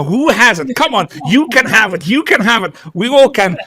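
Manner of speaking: high, loud auctioneer calling bids. Acted. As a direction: sell it like an auctioneer, shouting. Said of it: "I sound like an auctioneer right now".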